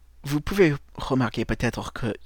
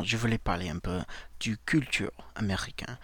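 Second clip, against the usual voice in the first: usual speaking voice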